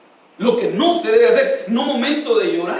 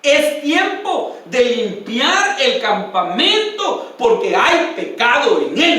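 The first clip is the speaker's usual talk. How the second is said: slow, high, commanding divine voice; voice of the Lord; 4 semitones higher, 4 dB louder, much slower than usual. Retold or imitated. God commanding Josué to cleanse the camp, slow and solemn. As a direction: speak as God giving the order, slowly. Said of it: "le dijo el Señor".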